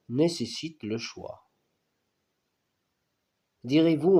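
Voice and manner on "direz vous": Direz-vous thrown out higher and louder, rising; rising indignant challenge. Acted. Poet challenging the philosophers: louder, higher indignant Direz-vous. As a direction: fling Direz-vous at the reader, raised. Same question repeated, raised.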